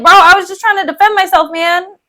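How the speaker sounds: high, rushed, protesting bro voice; Cassio. Retold or imitated. Cassio defending himself to Iago, high hurried protest. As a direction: plead your case fast and high. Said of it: "Cassio's just like".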